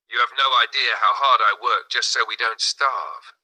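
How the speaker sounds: ordinary delivery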